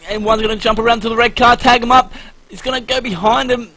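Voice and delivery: excited call. Real, not acted. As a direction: caught up in the action, voice climbing. Play-by-play in a tense moment.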